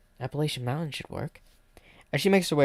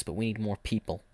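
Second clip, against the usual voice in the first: ordinary delivery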